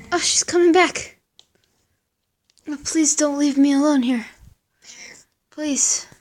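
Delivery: high loud begging; desperate pleading. Pretend. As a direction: plead not to be left alone. Begging cat.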